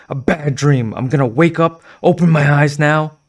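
begging herself to wake from the nightmare; desperate pleading. Acted. Girl begging herself to wake from a nightmare, desperate.

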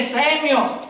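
distant calling voice. Acted. Voicing God calling again.

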